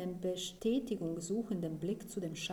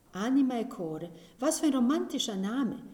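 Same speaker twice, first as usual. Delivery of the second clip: louder and higher, thrilled; excited; 5 semitones higher, 8 dB louder than usual. A narrator's reading. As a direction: gush over the romantic name. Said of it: "sieht Michael aufgeregt an".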